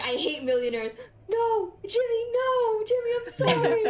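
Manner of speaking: high mock pleading, breaking into laughter; quoting a comic. Comic backtracking to Jimmy after the insult, high mock pleading. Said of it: "that one fucking comic is like".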